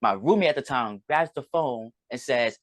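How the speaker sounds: ordinary talk, nothing marked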